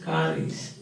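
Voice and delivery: slow "car is", run together as ca-ris; linking R. Shown as a demonstration. Teacher demonstrating R realized before a vowel, linking R. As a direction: carry the R of "car" into "is", slowly. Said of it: "linking R".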